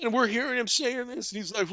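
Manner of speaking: ordinary delivery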